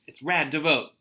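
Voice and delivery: upbeat. Acted. Teacher reading the book's fun line, excited. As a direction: sell it like a cool slogan.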